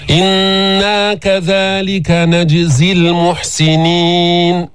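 slow sustained chant with a bounced ج in نجزي; tajweed recitation, long held vowels, bouncing ج in نجزي; qalqala on jim. Shown as a demonstration. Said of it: "أتينا بالقلقلة في الجيم الساكنة".

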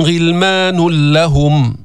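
غلمان لهم merged as ghilmanul-lahum, no nasal hum; idgham without ghunna. Shown as a demonstration. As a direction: chant غلمان لهم, sliding straight into a doubled ل. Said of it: "إدغام بدون غنة". (إدغام نون غلمان في لام لهم بلا غنة)

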